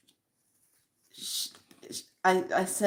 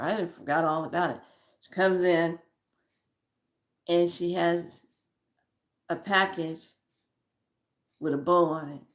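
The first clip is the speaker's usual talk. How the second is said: ordinary talk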